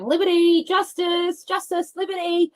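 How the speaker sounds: high-pitched call across the beach. Real, not acted. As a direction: call the dogs' names out. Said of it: "Feels really good to yell it out loud".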